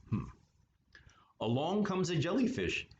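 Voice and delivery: plain reading voice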